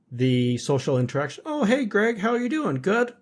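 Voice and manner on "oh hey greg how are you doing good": mock cheery greeting. Mocking coworkers' hallway small talk.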